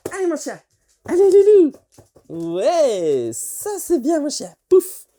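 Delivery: high, excited dog praise; talking to a dog. Shown as a demonstration. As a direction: cheer the dog on, high and bright.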